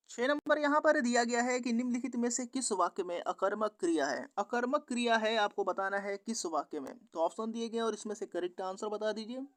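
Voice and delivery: ordinary delivery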